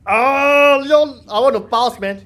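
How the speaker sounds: loud, high fan squeal; thrilled outburst. Real, not acted. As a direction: cry out in delight at the sight of a hero. Fan sees favourite character.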